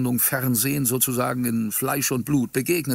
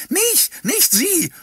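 very high, loud, drawn-out shriek; furious screech; 5 dB louder, much slower than usual. A narrator's reading. Old woman shrieking at her rival, furious screech. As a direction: screech each word in fury. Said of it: "kreischte sie wütend".

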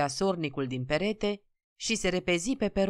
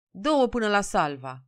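slightly raised voice, mildly meek request; subdued request; 4 semitones higher, 3 dB louder than usual. A narrator's reading. Peasant finally reaching the ticket window, mildly subdued request. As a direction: ask for tickets with only a touch of weariness. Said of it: "zise Nicolae plăștit".

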